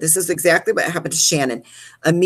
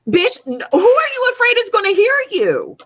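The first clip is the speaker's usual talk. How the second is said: high, loud mock confrontation; shrill-pitched incredulous telling-off; exasperated scolding; 4 dB louder than usual. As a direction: call out the whisperer, pitched way up.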